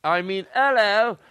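mock British accent as [name]. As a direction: play a cheesy Liverpool-ish Beatle. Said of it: "[name] appeared".

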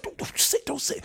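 hushed warning whisper. Retold or imitated. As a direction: whisper urgently to stop him. Hushing him at quiet dinner.

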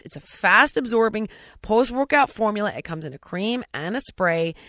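loud, emphatic radio commercial delivery; ad read. Real, not acted. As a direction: sell it to listeners with punch. Host reads a product ad.

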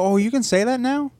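high, surprised question; mock surprise. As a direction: feign being amazed. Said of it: "I watch T V and I go".

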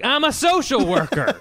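loud, high comic outburst; mock indignant yell. Social worker to a customer service worker, mock indignant yell. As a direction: yell it as a self-righteous excuse. Imagined rude woman yelling at worker.

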